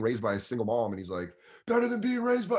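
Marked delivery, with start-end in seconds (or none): 1.7-2.6 high-pitched mock heckle; heckler yelling. Drunk fan beats comic to punchline, mock yell. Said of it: "is yelling out the punchlines".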